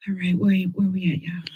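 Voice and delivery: quick whispered aside to herself. Real, not acted. Losing her place in the list, asking viewers in a fast whisper. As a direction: whisper it fast while checking notes.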